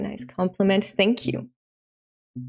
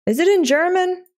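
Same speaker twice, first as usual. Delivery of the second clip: much higher-pitched incredulous question; mock alarm; 9 semitones higher, 4 dB louder than usual. Real, not acted. Streamer checks the chapter's language, mock-alarmed. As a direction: ask it in comic high-pitched disbelief.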